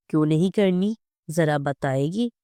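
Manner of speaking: usual speaking voice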